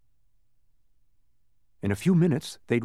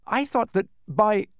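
desperate; 10 semitones higher, 5 dB louder than usual. A narrator's reading. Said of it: "he tried again desperately".

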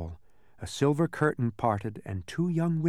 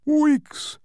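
much higher, louder squeaky correction; correcting bug; 8 dB louder than usual. A narrator's reading. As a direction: snap back a high, loud correction. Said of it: "corrected the bug".

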